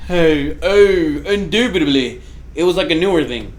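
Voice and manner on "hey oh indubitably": lightly hammed-up British toff, louder; mock upper-class British. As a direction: parody an upper-class English accent. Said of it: "upper class".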